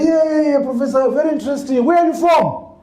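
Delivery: high mocking voice of condescending forum delegates; mock them, pitched high, breezing fast through "Professor, very interesting"; imitating dismissive delegates. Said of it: "And they'll say".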